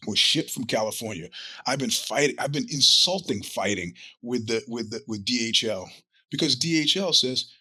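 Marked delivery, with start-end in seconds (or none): none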